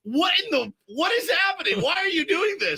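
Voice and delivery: very high, aghast pleading; hammed-up incredulity; retold disbelief. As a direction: protest in a high, bewildered voice. Retells dismay at friend's accent.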